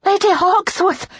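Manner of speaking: high, loud feverish cry; excited maid calling at high pitch; breathless excitement. A narrator's reading. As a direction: gasp it out, thrilled, after running. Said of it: "gasped with exertion and feverish excitement".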